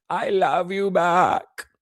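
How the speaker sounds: put-on high, slow Mexican-accented "I love you back", drawn out; a mock Kamala Harris with a Mexican accent, slow and high. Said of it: "in her Mexican accent".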